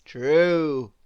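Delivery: confident booming storybook hero; Mister Impossible voice. A narrator's reading. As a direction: boom it out like a proud show-off. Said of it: "replied Mister Impossible".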